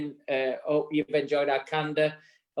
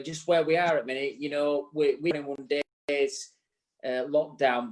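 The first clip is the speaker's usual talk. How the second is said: normal talking voice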